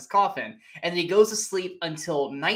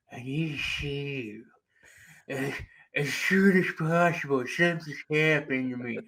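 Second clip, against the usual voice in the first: mock distressed patient; 3 semitones lower than usual. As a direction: drag it out haltingly like someone badly shaken. Retold troubled man phoning his therapist.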